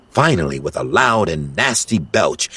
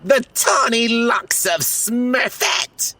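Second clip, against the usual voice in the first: slow, higher, savouring villain voice; gloating villain; slower than usual. Acted. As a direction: relish every word like treasure. Gargamel marvels at the hair.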